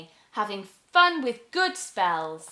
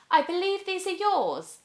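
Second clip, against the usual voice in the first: put-on higher, gentle young girl; polite girl; 10 semitones higher than usual. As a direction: hand them back kindly. Girl returns items.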